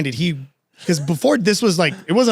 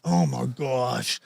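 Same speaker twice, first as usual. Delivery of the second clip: quiet dread; 4 semitones lower, 7 dB quieter, much slower than usual. Retold or imitated. Despair at bad news.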